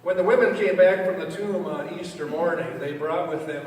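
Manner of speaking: loud, ringing sermon opening; preaching. Real, not acted. Preacher opens the Easter sermon, proclaiming loudly. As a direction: proclaim it to the congregation. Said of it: "preaching opening".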